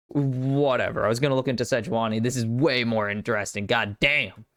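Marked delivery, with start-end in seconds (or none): none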